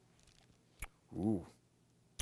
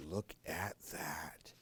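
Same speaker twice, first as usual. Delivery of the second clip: ordinary delivery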